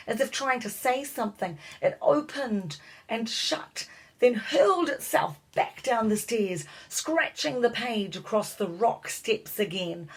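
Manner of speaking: plain reading voice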